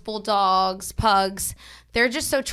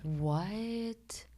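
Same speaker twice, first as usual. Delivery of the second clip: shocked whisper; 11 dB quieter than usual. Real, not acted. Shocked at short lifespan.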